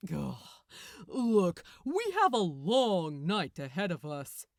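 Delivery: loud, exasperated archangel; impatient Gabriel. A narrator's reading. Gabriel telling Crowley he's dreaming, loud and exasperated.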